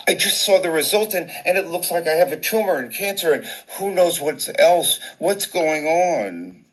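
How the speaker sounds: panicked, high and rushed, stretching the final plea; hysterical. Retold or imitated. Patient phoning the doctor's office about a scan result, hysterical. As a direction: pour it out in a high, frantic tumble. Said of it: "before I became hysterical".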